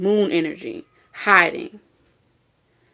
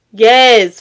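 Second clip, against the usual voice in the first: loud call-out; 10 dB louder than usual. Real, not acted. Calls out the viewer.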